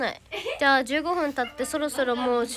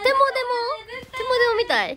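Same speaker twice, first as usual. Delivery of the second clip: mock whine; 10 semitones higher, 5 dB louder, slower than usual. Fan torn over which show to pick.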